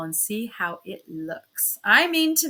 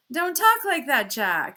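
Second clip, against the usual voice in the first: an urgent protest, pitched higher; hurried; 5 semitones higher, 4 dB louder than usual. A narrator's reading. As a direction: rush the words out to cut him off. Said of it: "I said hurriedly".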